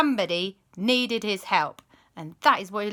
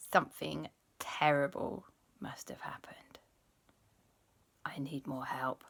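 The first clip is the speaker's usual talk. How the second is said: much softer, hushed inner thought; worried whisper; 3 semitones lower, 8 dB quieter than usual. Acted. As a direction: whisper the fear to himself.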